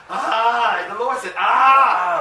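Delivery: a loud, pained groan for the Lord; anguished cry. Acted. Preacher voicing the Lord's pained cry. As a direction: let out the Lord's cry of disgust, high and loud.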